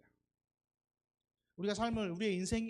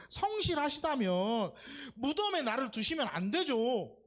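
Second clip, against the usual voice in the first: pleading protest; 4 semitones higher, 3 dB louder than usual. Retold or imitated. Psalmist demanding that God rescue him, loud and pleading. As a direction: press God for an answer, voice rising. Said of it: "반대로 물어보는 거지".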